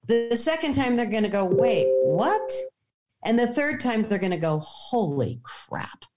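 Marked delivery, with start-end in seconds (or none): 1.4-2.5 acted surprise with a rising question; puzzled double take. Imagined puzzled second viewing. 4.6-6.1 slow, low, hushed mock amazement; stunned awe. Imagined astonished third viewing.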